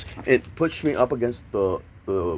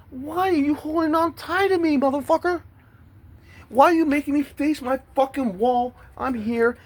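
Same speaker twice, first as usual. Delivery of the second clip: much higher, slower outraged complaint; retold furious protest. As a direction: spit the complaint out high and indignant. Retold protest while held against wall.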